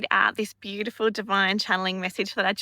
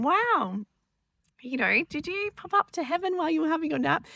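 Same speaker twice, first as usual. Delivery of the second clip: put-on much higher, sing-song voice for a small child; both parents ask the boy about heaven, higher playful coo; playful wonder; 8 semitones higher than usual. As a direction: coo at the boy in gentle amazement. Parents marvel gently to son.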